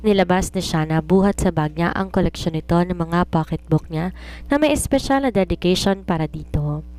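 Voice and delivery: ordinary delivery, nothing marked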